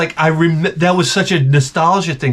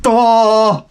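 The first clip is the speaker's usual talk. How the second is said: put-on slow, higher dopey kid; dumb kid voice; 9 semitones higher, much slower than usual. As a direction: play the lazy dim kid. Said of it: "he comes in the house".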